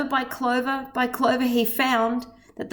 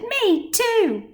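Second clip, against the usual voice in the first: small high joey's voice, higher; a tiny kid chiming in, pitched higher; little kangaroo; 10 semitones higher than usual. A narrator's reading. Joey taunting Horton from the pouch, high childish voice. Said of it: "the young kangaroo in her pouch said".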